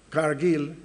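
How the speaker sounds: normal talking voice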